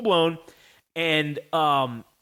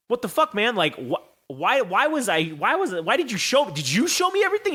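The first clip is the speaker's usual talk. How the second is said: stammering, rising to high-pitched disbelief; baffled protest; 5 semitones higher than usual. Retold or imitated. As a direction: splutter in acted-out bewilderment. Retold baffled reply to Triple H.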